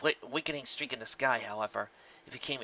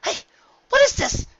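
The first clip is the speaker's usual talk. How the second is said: high, loud alarm; surprised exclaim; 11 dB louder than usual. A narrator's reading. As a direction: caught off guard. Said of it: "she exclaimed, surprised".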